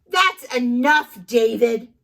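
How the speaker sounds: loud stern reprimand. Acted. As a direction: put your foot down. Mom scolding.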